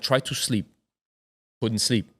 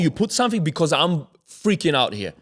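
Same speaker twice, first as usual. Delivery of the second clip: higher, agitated retold panic; anxious insistence; 6 semitones higher than usual. Confronting the laughing friend in the car, freaking out. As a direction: insist in mounting alarm, pitched up. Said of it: "I start getting anxiety".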